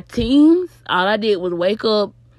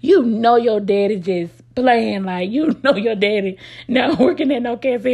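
laughing; 4 semitones higher, 3 dB louder than usual. Retold or imitated. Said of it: "mama just bust out laughing".

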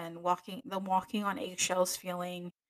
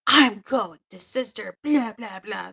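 mock tattletale; 2 semitones higher, 7 dB louder, slower than usual. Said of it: "she ends the conversation by saying".

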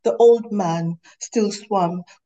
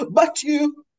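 yelling; 10 semitones higher, 4 dB louder than usual. Acted. Heated argument, yelling back.

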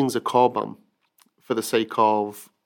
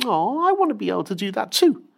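put-on little boy wishing; 11 semitones higher, 5 dB louder than usual. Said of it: "And he's thinking".

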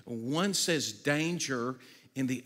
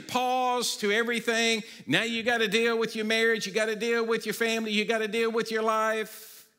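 loud proclamation; 9 semitones higher, 9 dB louder than usual. Acted. World telling people to pause.

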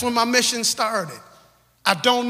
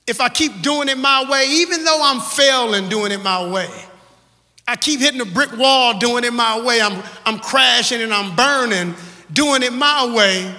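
louder preaching on "my way"; building refrain; 4 dB louder than usual. Real, not acted. Preacher hammering the refrain to the church. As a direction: lean into every "my way".

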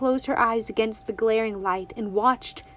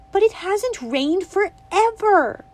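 put-on higher and louder, a worried child; a girl suddenly worried for the toad; mild alarm in a child's voice; 11 semitones higher, 6 dB louder, slower than usual. A narrator's reading.

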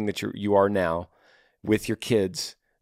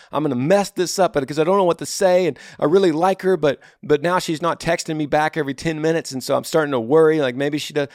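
higher-pitched mock fretting; nervous, desperate; 5 semitones higher than usual. Imitating a viewer emailing in a panic over a girl, nervous and desperate. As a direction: imitate a lovesick worrier working himself up. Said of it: "and then you're desperate".

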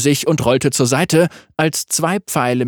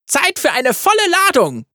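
shouting; 13 semitones higher than usual. A narrator's reading. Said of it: "schrie sie".

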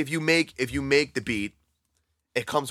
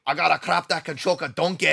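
put-on higher, louder Scottish accent, laughing through it; a Scottish-accented Fat Bastard line; Fat Bastard impression; 6 semitones higher, 5 dB louder than usual. Friends comparing Mike Myers voices, Scottish Fat Bastard voice. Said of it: "he's Fat Bastard and Shrek is the same character".

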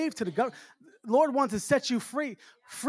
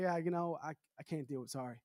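softer, dropping, mumbled excuse; reluctant refusal; 7 semitones lower, 13 dB quieter than usual. Retold or imitated. Friend turning down the pastor with the flat, quiet and reluctant. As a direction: back out of the promise, trailing away.

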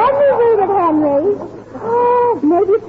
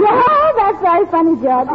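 usual speaking voice

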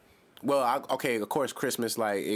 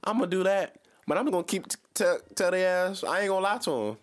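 high excited scheming; 8 semitones higher than usual. Acted. Scheming about starting a religion.

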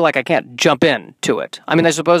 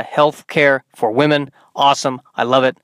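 ordinary delivery

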